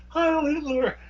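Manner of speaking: very high, mocking imitation; hammed-up high-voiced mockery; mock salute. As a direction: pitch it way up as a taunting cry. Imitating the woman mocking the speaker.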